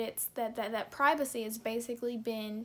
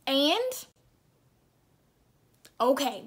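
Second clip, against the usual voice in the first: sassy comeback; 6 dB louder, slower than usual. Real, not acted. Snapping back at critics.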